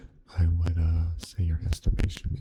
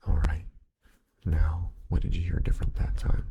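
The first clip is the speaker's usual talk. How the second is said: ordinary delivery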